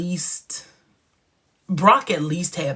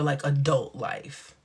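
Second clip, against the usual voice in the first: normal talking voice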